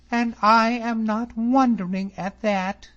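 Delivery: high, loud, cutting; mock him coldly; scornful young lady. A narrator's reading. Miss Drummond scorning David, high and sharp. Cold retort.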